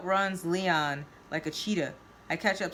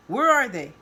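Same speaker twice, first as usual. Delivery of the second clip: loud, raised angry demand; roaring; 5 semitones higher, 9 dB louder than usual. A narrator's reading. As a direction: roar the question at them.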